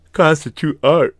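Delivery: words swallowed by a loud yawn; high, loud, yawn breaking through the words. Real, not acted. Host finishing a point about art, yawning.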